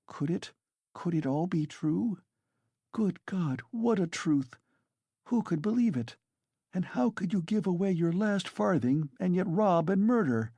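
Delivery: high, stunned and pleading; shocked disbelief. A narrator's reading. Sonia to Raskolnikov, aghast at his confession, high and incredulous. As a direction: voice climbing in horrified wonder.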